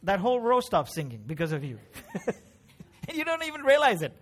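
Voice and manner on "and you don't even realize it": high, quick words through a laugh; amused, breaking into laughter. Real, not acted. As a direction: laugh while saying it. Preacher laughs at his own example.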